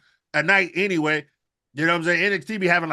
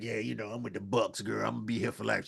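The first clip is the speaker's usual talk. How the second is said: much softer, low mock Okada; imitating Okada; 10 semitones lower, 12 dB quieter than usual. Host voicing Okada pledging loyalty to the Bucks, low and soft. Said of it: "he gonna be like".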